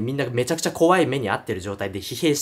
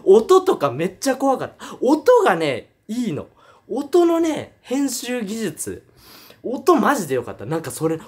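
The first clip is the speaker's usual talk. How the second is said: normal talking voice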